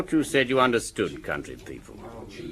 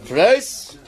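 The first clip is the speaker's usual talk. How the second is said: calling out; 10 semitones higher than usual. Acted. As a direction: sing out the order across the room. Landlord calling out to the kitchen.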